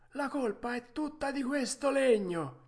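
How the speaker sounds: vocina acuta da vecchio falegname; mastro falegname. Acted. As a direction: rispondi in tono molto acuto, da anziano artigiano. (vocetta alta del falegname)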